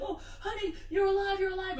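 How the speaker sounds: high-pitched, hammed-up joyful cry; mock overjoyed relief. Deaf man seeing his wife wake, mock overjoyed relief. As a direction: play a husband's delighted disbelief, rising in volume. Husband finds wife waking up.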